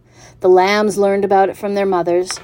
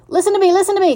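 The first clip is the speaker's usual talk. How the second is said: high, loud squawked demand; goose screaming; 13 semitones higher, 5 dB louder, much faster than usual. A narrator's reading. Goose competing for the pig's attention, high scream. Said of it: "screamed the goose".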